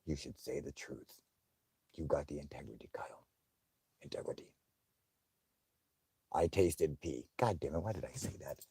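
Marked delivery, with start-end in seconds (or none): 0.1-4.5 much softer, self-urging murmur; inner voice. Retold or imitated. His conscience urging honesty, a much softer murmur. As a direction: coax yourself under your breath. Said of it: "I'm like".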